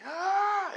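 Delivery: high, drawn-out acted scream; scream of pain. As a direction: cry out high as if burned. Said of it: "screaming out in pain".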